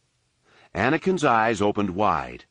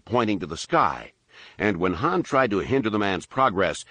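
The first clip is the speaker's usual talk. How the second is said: plain reading voice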